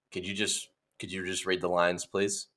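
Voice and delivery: low, soft, restrained interruption; quiet polite request. Acted. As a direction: cut in softly and low. Unimpressed casting panel stops him.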